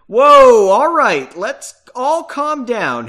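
big high "whoa", easing off; urgent peacemaker cutting in; loud interruption. A narrator's reading. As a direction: break in loudly, then slow to placate. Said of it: "Henry interrupted".

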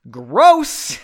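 acted loud "ew" reaction; disgusted outburst. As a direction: recoil and blurt it out. Disgusted slap-back in read dialogue.

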